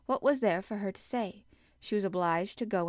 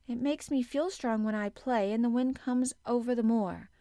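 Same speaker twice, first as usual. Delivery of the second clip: put-on high, louder child making a case; insistent, pitched-up girl; arguing; 4 semitones higher, 5 dB louder than usual. A narrator's reading. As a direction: argue earnestly as a small girl. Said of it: "argued Mary".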